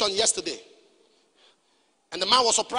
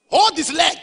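loud repeated command; 5 semitones higher, 7 dB louder, slower than usual. Real, not acted. Preacher ordering the young man to beg, loud and high. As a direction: Hold said higher and much louder.

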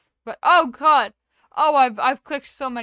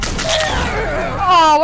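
sudden loud yelp; surprised shout; 8 semitones higher, 10 dB louder than usual. Real, not acted. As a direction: yelp in shock. Killed in game.

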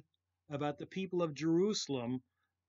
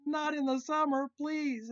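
mock plea; 11 semitones higher, 6 dB louder than usual. Mock plea over broken fridge.

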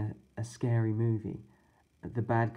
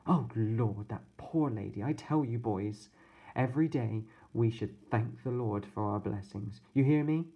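a hint of a scolding, pious babysitter; lightly voiced babysitter. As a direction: lecture the boys with a touch of sternness.

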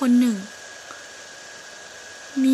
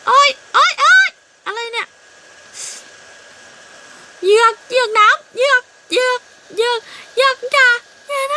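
much higher, loud panicked yelling; panicked cries; 12 semitones higher, 7 dB louder than usual. Acted. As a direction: cry out as the jug tips. Jug about to fall, panic. (ร้องตกใจเสียงสูงดังกลัวเหยือกแตก)

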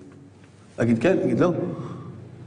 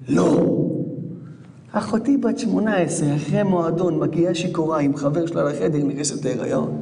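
normal talking voice

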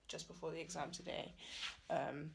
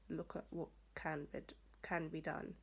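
ordinary talk

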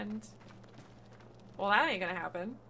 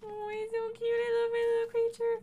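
cooing; 9 semitones higher than usual. Real, not acted. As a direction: coo over the little creature. Cooing at game creature.

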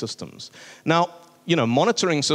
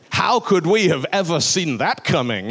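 sarcastic mock wonder; 5 semitones higher, 3 dB louder than usual. Mock surprise at yearly expiry.

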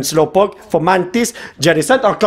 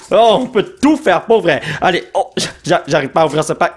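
ordinary talk, nothing marked

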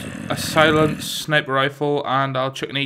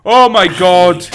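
loud, high shaken gasp; shaken exclamation; 4 semitones higher, 11 dB louder, slower than usual. Real, not acted. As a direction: blurt it out, still rattled. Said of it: "That really did scare me".

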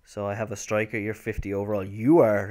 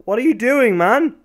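loud, high exasperated yell; frustrated shout; 12 semitones higher, 11 dB louder than usual. Real, not acted. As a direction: yell at the useless players. Frustrated at his players.

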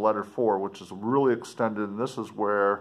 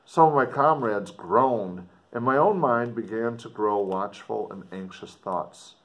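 put-on earnest, dramatic reading of an old letter; story narration; 2 semitones higher, 4 dB louder than usual. A narrator's reading. Explorer writing home to his sister, dramatic story reading. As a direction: read it like a gothic novel, with feeling. Reads letter four aloud.

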